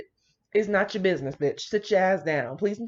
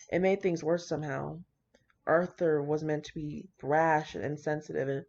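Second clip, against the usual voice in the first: low-key reading voice, a touch softer than her chat; just read the story out steadily; story narration; 3 semitones lower, 4 dB quieter than usual. Acted. Reads fan fiction aloud.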